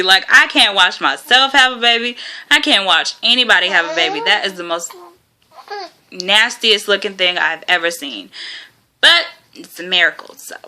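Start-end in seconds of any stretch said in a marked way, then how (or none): none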